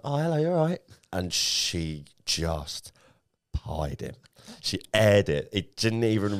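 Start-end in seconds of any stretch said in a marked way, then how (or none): 0.0-0.8 mock smooth greeting. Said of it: "it's like".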